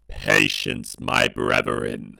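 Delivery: slow, low, chilling robot growl; booming robotic voice. Acted. Dalek leader addressing the ship, slow booming robotic voice. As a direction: intone it slowly as a menacing machine leader. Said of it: "a loud booming, chilling robotic voice bellows out".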